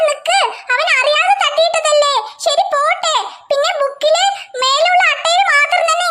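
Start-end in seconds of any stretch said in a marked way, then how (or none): none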